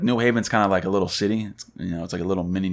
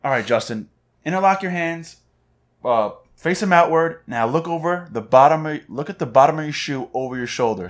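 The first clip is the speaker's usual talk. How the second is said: higher-pitched imitation of a prankster's instructions; lightly acted prankster; 7 semitones higher than usual. Imitating prankster instructing Justin, lightly acted setup. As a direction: coach a friend through it, pitched up. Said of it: "or he tells him".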